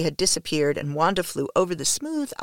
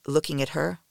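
usual speaking voice

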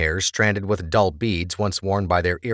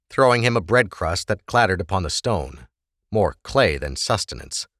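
ordinary delivery

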